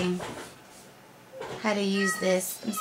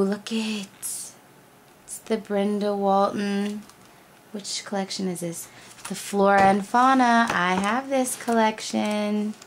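usual speaking voice

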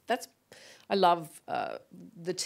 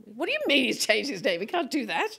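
higher, quicker-rising mock protest; indignant disbelief; 5 semitones higher than usual. Mother protesting to the teacher, mock-indignant disbelief. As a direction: act out a parent who can't believe it.

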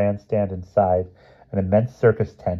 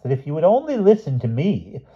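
higher-pitched formal appeal; prim insistence; 8 semitones higher than usual. A narrator's reading. Mister Smith to squabbling children, primly insisting. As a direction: insist politely on being heard.

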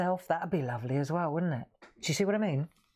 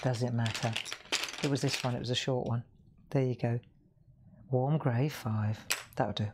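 ordinary talk, nothing marked

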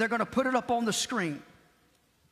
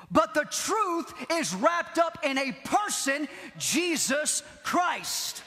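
high, ringing pulpit preaching; preaching at full force; 4 semitones higher than usual. Acted. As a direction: drive each line home to the congregation. Preaching climax, raised pitch.

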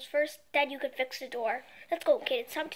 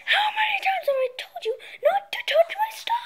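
high, loud furious shout; angry yell; 6 dB louder than usual. Acted. Villain father yelling at his son. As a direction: blow up at the kid.

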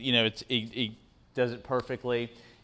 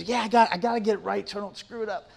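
put-on higher-pitched kid voice, hurried at the end; imitating upset son; 8 semitones higher, faster than usual. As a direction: mimic an anxious kid pulling himself together. Voices upset son's reply.

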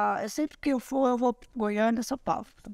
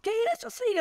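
mock surprise; 11 semitones higher, faster than usual. Grandmother retelling her reaction to her daughter at a party, mock surprise. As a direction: question a daughter in playful disbelief.

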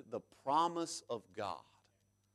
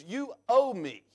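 demanding payment; 7 semitones higher, 4 dB louder than usual. Retold or imitated. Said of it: "goes to the yard owner and says".

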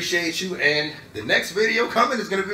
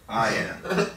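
low quiet solemn line; a lightly voiced Iron Man, grave and quiet; solemn hero line; 7 semitones lower, 4 dB quieter than usual. Iron Man before his snap, low solemn quiet line. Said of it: "Then he says".

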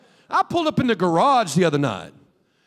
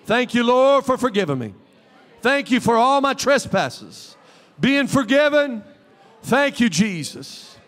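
slow, rising fervent thanks; praise prayer; 3 semitones higher, slower than usual. Shown as a demonstration. Pastor leads the church in fervent thanks, slow. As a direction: lift it up in worship. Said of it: "raise your hand and say".

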